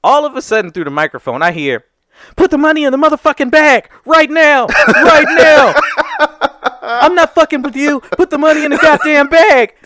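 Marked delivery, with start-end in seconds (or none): 2.4-9.7 higher, louder mock threat, breaking into a laugh; yelling robber. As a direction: bark the hold-up demand, half laughing. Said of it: "he's still yelling at this dude".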